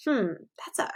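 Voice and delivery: soft unvoiced hmm; musing whisper. Real, not acted. Teacher musing over a new word to kids. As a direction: wonder aloud under your breath.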